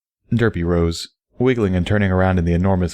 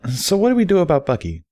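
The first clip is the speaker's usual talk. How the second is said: lightly raised, teasing mare explaining; a playful, knowing friend giving advice; Barry's voice; 10 semitones higher than usual. Acted. Barry teasing then advising Derpy, lightly higher.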